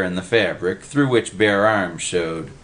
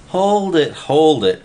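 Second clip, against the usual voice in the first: loud, raised-pitch order; commanding; 9 semitones higher, 5 dB louder than usual. A narrator's reading. Freddy tries to restore order, commanding. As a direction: halt the commotion at once. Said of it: "Freddy commanded".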